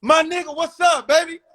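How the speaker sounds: high, loud street call; yelling across the street. Retold or imitated. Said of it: "He yell across the fucking street".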